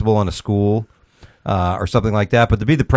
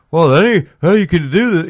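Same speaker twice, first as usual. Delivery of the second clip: high-pitched, hammed-up whiny protest; mocking outraged Democrats; 8 semitones higher, 4 dB louder than usual. Host mimicking Democrats objecting to Russia ties, mock indignation. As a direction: caricature their indignant objection.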